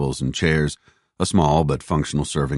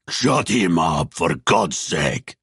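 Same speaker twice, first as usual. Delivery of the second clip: slow, drawn-out angry order; shouted; much slower than usual. A narrator's reading. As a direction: growl it out as an exasperated commander. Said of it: "Stepan shouted".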